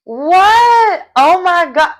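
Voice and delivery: loud, high-pitched astonished outburst; played-up utter disbelief; jaw-dropped shock. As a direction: exclaim it high, stretching the last words. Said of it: "My jaw hit the floor".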